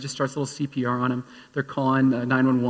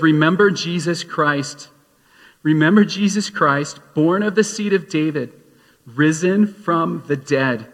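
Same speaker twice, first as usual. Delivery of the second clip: slow, louder, weighted scripture reading; preaching scripture; 5 dB louder, slower than usual. Acted. Pastor reciting Paul's letter to Timothy, slow and forceful. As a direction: lean on every word from the pulpit.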